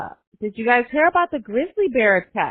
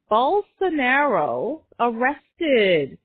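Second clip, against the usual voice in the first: drawn-out Bolsonaro; much slower than usual. Shown as a demonstration. Name drawn out for emphasis.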